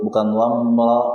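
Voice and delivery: ordinary talk, nothing marked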